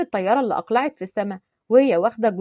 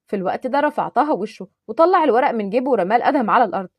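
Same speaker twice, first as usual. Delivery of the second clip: ordinary delivery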